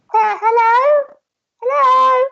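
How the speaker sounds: high, slow squeaky little elf calling out; timid elf voice. Acted.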